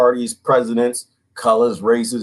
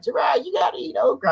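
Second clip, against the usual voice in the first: mimicking a friend; 4 dB quieter than usual.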